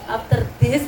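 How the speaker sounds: put-on "After this" with a light Javanese accent; Javanese-accented English. Mother repeating daughter's medok English, lightly. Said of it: "tolong ngomong bahasa Inggris yang medok".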